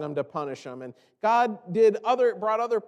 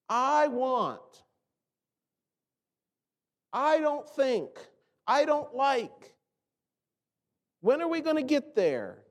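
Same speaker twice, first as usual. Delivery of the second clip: put-on childish whining; 5 semitones higher, slower than usual. Preacher mimicking selfish whining.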